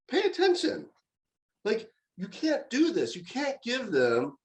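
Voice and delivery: high-pitched, disbelieving mock rant at the parents; imagined exasperated outburst. Dad imagines scolding the other parents, high exasperated rant. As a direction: vent like you want to flip the table.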